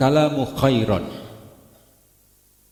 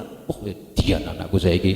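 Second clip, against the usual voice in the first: mock-proud, low marvelling; admiring exclamation; 6 semitones lower than usual. Parent boasting about their child, in mock admiring amazement. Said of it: "Wah, anake diedan-edanke".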